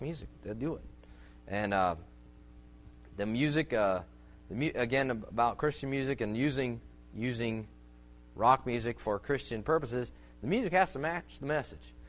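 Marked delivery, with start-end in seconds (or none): none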